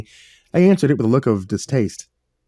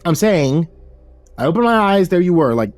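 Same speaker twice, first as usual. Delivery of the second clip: higher, flustered stammer; higher flustered hero voice; 7 semitones higher than usual. A narrator's reading. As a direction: stammer out a nervous backpedal. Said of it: "I held up my hand and stammered".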